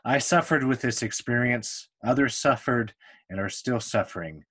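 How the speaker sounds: ordinary delivery, nothing marked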